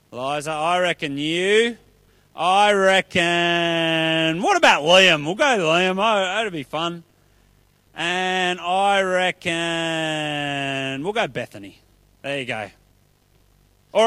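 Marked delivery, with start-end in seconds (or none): 2.3-4.4 long, held-out words while choosing; drawn-out deliberation. Real, not acted. Host picking a child from the crowd, drawn-out deliberation. Drawing out the choice of volunteer. 7.9-11.0 very slow, stretched-out suspense; drawn-out deliberation. Real, not acted. Host picking the last volunteer, drawn-out deliberation.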